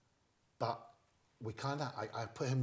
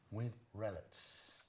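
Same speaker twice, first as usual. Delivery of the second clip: slow, drawn-out With relish; slow deliberate line; 4 dB quieter, much slower than usual. Shown as a demonstration. Coaching the friend with a slow deliberate line. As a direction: spell out each word for the actor. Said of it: "got him to say".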